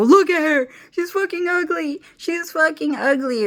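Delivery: high mocking jeer, pointing at her; mocking shout. Mocking friends on camera, high jeering voice. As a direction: sneer it out high like a cruel prankster. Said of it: "flip the camera around and go".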